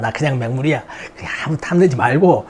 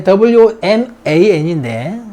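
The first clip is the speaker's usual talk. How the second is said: slow spelling; much slower than usual. Shown as a demonstration. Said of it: "spelling out letters".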